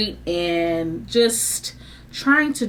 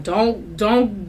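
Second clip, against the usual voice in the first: usual speaking voice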